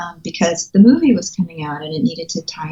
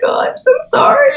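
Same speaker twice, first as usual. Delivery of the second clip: teary apology. Retold or imitated. Sister crying over the book.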